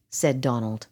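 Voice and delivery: usual speaking voice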